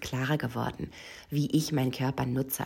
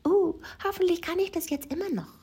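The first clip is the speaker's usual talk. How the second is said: much higher, anxious inner voice; acted-out apprehension, pitched way up; voiced worry. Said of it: "kommt keine Lust, sondern eher ein".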